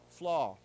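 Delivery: Flaw said loud and clear, drawn out; loud model word. Shown as a demonstration. Teacher modelling Flaw for students to repeat, loud and clear. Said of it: "say this word again".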